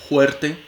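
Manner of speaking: "fuerte" said "huerte", f breathed out as h; glottal h for f. Shown as a demonstration. Teacher demonstrating the glottal f in fuerte. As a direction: turn the f of "fuerte" into a throaty h. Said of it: "Acá atrás, no aquí".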